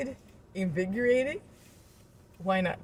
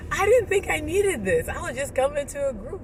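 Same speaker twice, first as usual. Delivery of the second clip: much higher, louder marvelling; higher, louder surprised thanks; amazed gratitude; 9 semitones higher, 9 dB louder than usual. Retold or imitated. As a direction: exclaim it in happy disbelief. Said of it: "And you're like".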